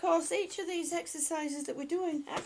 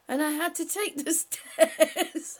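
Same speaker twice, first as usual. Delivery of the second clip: laughing; 3 semitones higher, 11 dB louder than usual. Real, not acted. Reading joke, laughing.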